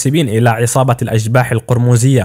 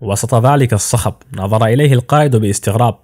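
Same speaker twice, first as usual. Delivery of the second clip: ordinary delivery, nothing marked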